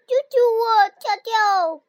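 loud high panicked cry; crying for help. Acted. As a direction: scream for help while sinking. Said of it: "他的呼救声".

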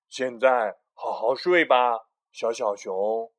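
loud, slow bedtime bear; Big Bear voice. Acted. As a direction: tuck the cub in firmly. Big Bear tucks in cub.

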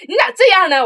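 the teacher snapping at her on the phone; mock scolding teacher. Teacher turns harsh on phone. (模仿老师突然变脸训斥)

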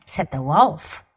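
normal talking voice

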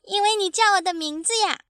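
put-on high, quick child's voice; trusting baby dinosaur. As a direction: chirp it eagerly like a small child. (天真的小恐龙童声)

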